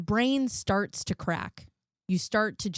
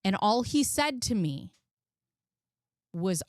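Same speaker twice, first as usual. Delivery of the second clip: ordinary delivery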